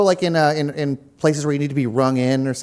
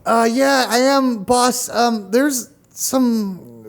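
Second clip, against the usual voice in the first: eager assistant voice; 6 semitones higher, much slower than usual. Acted. Playing the cameraman Gregory.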